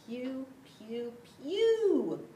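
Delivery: rising disgusted cry of pew. Acted. Dog reacts to stink.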